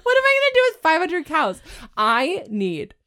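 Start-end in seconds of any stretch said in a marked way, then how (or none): 0.0-1.6 fast, high, laughing; laughing outburst. Real, not acted. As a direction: cry out through laughter.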